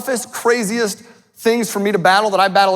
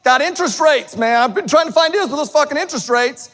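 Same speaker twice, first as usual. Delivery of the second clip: mock frantic complaint; 7 semitones higher than usual. People griping to the speaker about rates, in a mock frantic voice. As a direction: ham up a stressed-out investor griping. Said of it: "People coming up to me telling".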